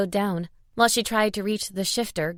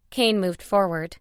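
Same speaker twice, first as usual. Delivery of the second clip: ordinary delivery, nothing marked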